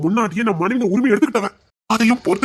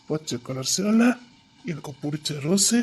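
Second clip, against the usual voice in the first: put-on drunk slur; 4 semitones lower, 7 dB quieter, slower than usual. Drunk husband slurring sweet talk.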